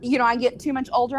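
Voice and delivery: normal talking voice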